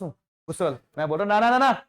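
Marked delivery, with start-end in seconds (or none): 1.4-1.8 louder, higher bossy orders; angry boss refusing the sample. Acted. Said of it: "मैं गुस्से वाला boss हूं".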